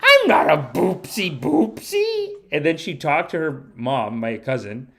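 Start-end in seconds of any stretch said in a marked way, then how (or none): 0.0-2.3 put-on high, slow, indignant kid voice; imitating a cross little girl; mad little girl. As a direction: protest it like an offended small child. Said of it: "She's getting mad. She's like".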